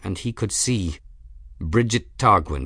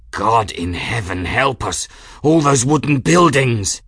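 horrified outburst; 2 semitones higher, 5 dB louder than usual. A narrator's reading. Aeronaut realising wooden buildings will burn, horrified outburst. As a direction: cry out in mounting horror.

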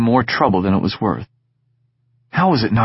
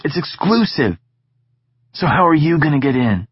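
put-on lightly higher, teasing young friend; Egan's voice, cocky; 8 semitones higher than usual. Egan needling Sid about school, teasing. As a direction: tease your friend about his tone.

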